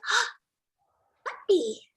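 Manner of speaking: put-on high, bright squeal of a small child; a toddler lighting up at a puppy; delighted child. Four-year-old patient spotting the dog, high and delighted.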